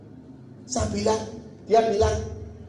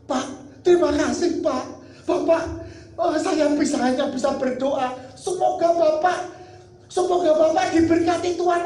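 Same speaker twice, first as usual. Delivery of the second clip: tearful thanks; 7 semitones higher, 4 dB louder than usual. Retold or imitated. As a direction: imitate high, tearful gratitude. (menirukan ucapan terima kasih sambil menangis)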